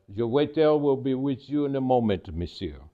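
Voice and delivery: put-on amateur French accent, courteous head waiter; attempted French accent. Maitre d' greeting a diner, attempted French accent. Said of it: "Forgive my French attempt here".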